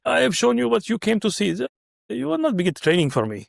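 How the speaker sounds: high-pitched mock Russian accent; imitated Russian doctor. Russian cardiologist refuses to teach, in a Russian accent. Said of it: "he's a Russian cardiologist".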